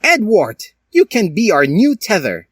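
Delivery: high, loud mock sneer. Professor Skellington naming Edward the new teacher, in a high loud sneer. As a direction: sneer it out with false kindness. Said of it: "he sneered warmly".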